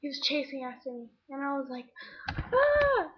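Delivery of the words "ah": high, loud mock scream; retold scream. Girl chased after falling off her bike, mock scream. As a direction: let out a short acted scream.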